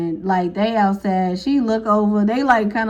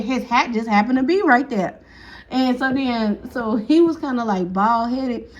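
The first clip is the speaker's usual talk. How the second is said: ordinary talk, nothing marked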